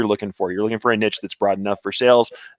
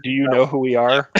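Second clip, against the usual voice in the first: mock self-important; 2 semitones higher than usual. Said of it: "I couldn't even say that with a straight face".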